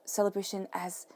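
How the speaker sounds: usual speaking voice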